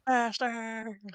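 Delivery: small, high, soft cry; mock distant yell. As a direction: imitate a yell with the volume turned down. Echoes the rider's yell, softly.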